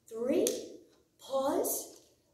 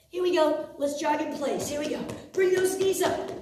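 aerobics instructor; 4 semitones higher than usual. Acted. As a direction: pump the class up, shout it out. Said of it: "coach pumping up jogging".

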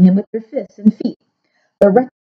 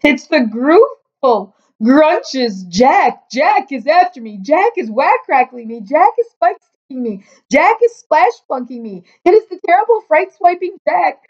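high wailing panic, climbing higher as it goes; terrified bellowing; 8 semitones higher than usual. A narrator's reading. Giant dreaming of a giant killer, bellowing in terror. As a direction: cry out each line high, in mounting terror. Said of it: "bellowed the flesh lump eater".

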